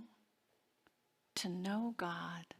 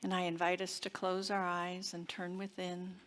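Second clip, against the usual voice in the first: affirmative prayer. Acted. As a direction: lead the congregation inward, gently and unhurried. Leads a spoken affirmative prayer.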